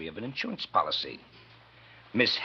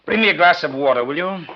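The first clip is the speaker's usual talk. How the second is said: loud, raised order to the waiter; 7 semitones higher, 9 dB louder than usual. Acted. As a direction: call the order across the room.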